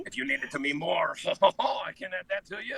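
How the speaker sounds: hammed-up French accent with a laugh. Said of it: "Can you do a French accent?".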